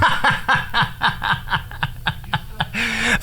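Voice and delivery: soft giggle, way up high; high breathy laugh. Real, not acted. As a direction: laugh lightly at the joke. Interviewer laughs at joke.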